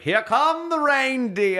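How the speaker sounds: high, drawn-out grandmother heralding a parade; sing-out announcement, loud and stretched; Swoopy announcing. Retold or imitated. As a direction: belt it out slowly like a delighted Southern grande dame. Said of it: "She'd announce them".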